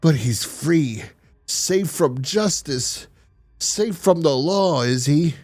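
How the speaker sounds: very slow, dragged out on safe from justice; dwelling on the injustice, word by word; bitter brooding. Retold or imitated.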